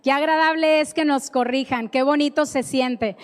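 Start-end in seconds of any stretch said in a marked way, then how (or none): none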